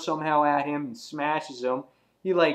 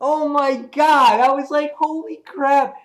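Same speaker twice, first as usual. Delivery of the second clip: wowed fan exclaiming; stunned disbelief; 10 semitones higher, 6 dB louder than usual. Real, not acted. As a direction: stretch it out, astonished. Reliving shock at the panel.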